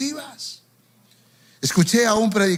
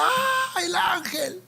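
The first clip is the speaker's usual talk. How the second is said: gritito agudo y contenido, remedando a la multitud; imitando llanto y gritos; 10 semitones higher, 5 dB quieter, much slower than usual. Retold or imitated. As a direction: remeda un chillido lloroso, muy agudo y suave. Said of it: "la gente lloraba, gritaba". (falso grito histérico de la gente)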